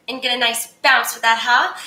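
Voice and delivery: ordinary talk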